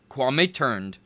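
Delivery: plain reading voice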